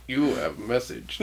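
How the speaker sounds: put-on soft, flat robotic kid voice; robot child voice. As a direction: read it like a computer child. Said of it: "talking like a robot child".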